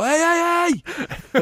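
yelling up at neighbors. Pretend. Tenant hollering at upstairs neighbors, mock Brooklyn yell. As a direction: bang the ceiling and holler upstairs.

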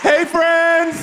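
high, loud, thrilled shout hello; excited greeting. Real, not acted. Guest walks onstage and hails the audience, thrilled shout. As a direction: burst onstage calling out to the crowd.

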